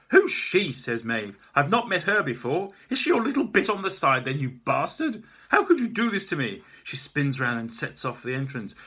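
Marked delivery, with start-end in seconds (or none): 0.1-0.7 loud, high snapping question; scorned artist demanding; jealous demand. Acted. Maeve jealous outburst. 1.5-6.6 loud, higher wounded accusation; betrayed woman shouting; jealous rage. Acted.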